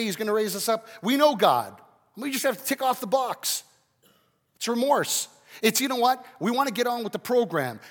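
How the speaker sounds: high, loud sermon delivery; impassioned preaching. Acted. Preacher confronts congregation about shallow remorse, impassioned preaching. Preaches at raised pitch and volume.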